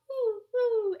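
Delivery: high, loud hoot; owl hollering. Acted. The outraged owl hoots loudly. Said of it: "who hollered".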